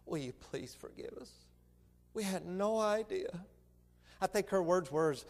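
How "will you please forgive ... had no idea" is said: imitating a soft, remorseful plea; hushed apology. Lady asking the family's forgiveness by phone, hushed and contrite. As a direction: ask forgiveness very quietly, as a retold quote. Retells the tearful couple's apology.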